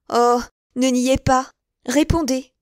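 loud, slow-starting command; demanding. Acted. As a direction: press her hard for an answer. Milady demands an answer.

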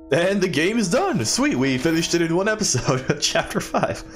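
high-pitched, triumphant game-over cheer; celebrating. Real, not acted.

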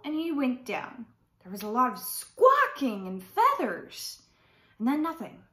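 usual speaking voice

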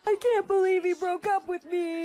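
high, distressed voice; upset lament. Acted. Girl to friend about being dumped, upset lament.

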